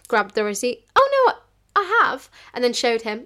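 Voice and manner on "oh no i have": very high, loud acted correction; acted protest. Waitress insisting to a customer she has, high loud protest. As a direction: pitch it right up and insist.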